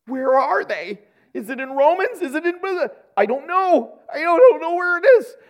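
rising panic, can't find the verse; mock panic. A believer hunting the Bible for the sinner's prayer, in mock panic.

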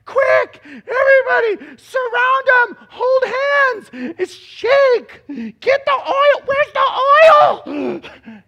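high, frantic shouted orders; mock panicked shouting. Preacher making fun of a spiritual nut rallying everyone, frantic high shouting. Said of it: "you wonder if Jesus is like".

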